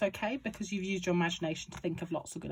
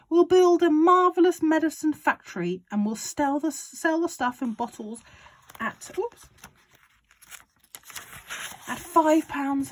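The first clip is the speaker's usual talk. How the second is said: high, loud, gleeful father; excited Mister Cranky; 8 semitones higher, 9 dB louder than usual. A narrator's reading. Father urging George on about the medicine, high and loud. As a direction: crow it like a man with a scheme. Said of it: "cried Mister Killycranky".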